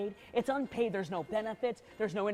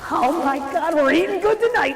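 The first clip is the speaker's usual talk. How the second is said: high and loud delight; excited; 7 semitones higher, 9 dB louder than usual. Acted. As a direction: whoop with excitement. Elf excited about food.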